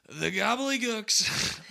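high, very loud, slow politician impression; Trump or Biden. Comic riffing on Biden speaking from the heart, loud high impression. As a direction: belt it out as a mock political outburst. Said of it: "that was Biden. Or that was Trump".

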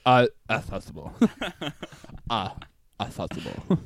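low pinched nasal correction; nasal mock voice. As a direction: say it all through the nose. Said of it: "speak through your mouth and not your nose".

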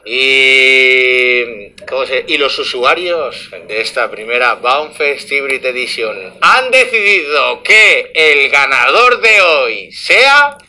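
slow, drawn-out suspense build; TV host voice. Shown as a demonstration. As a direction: announce it like a TV gala host. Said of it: "vamos a hacerlo un poco más televisivo". (presentador de televisión con suspense)

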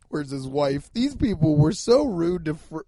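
usual speaking voice

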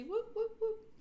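high, bright whoops of joy; whooping cheer. Real, not acted. Host cheering to her listeners, excited whoops.

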